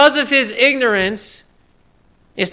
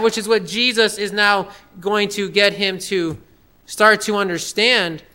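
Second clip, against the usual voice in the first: usual speaking voice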